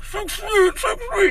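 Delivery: very high, muffled mock wailing; muffled cry. Old lady caught in an unwanted hug, muffled mock crying. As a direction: squeal it as if smothered in a shoulder, imitating her crying. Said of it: "her voice is all muffled".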